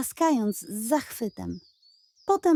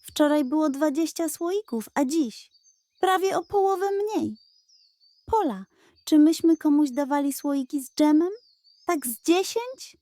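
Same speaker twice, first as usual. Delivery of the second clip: higher, quick puzzled questioning; puzzled Klaudia; 6 semitones higher than usual. Acted. Klaudia asking Pola about missing jars, puzzled. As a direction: count it up, baffled.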